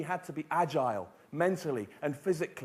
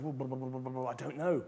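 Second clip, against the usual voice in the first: low, soft, mumbled blah-blah; stalling babble; 5 semitones lower, 7 dB quieter than usual. Retold or imitated. Asked how to unlock their potential, stalling in a low mumble. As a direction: babble it low and quiet, playing at a loss. Retelling his clueless, stalling answer.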